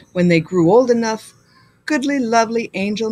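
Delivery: plain reading voice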